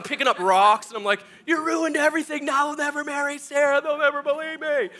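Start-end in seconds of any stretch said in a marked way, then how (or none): 1.5-4.9 high, sustained whining wail; mock tearful tantrum. Hired hand throws rocks at horses, high mock wail. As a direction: wail it in hammed-up despair.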